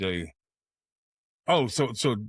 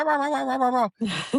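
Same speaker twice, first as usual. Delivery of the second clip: high yapping rah rah like a small dog; imitating yappy barking; 11 semitones higher than usual. Joking about the friend's dog, high mock yapping. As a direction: yap it out like a chihuahua.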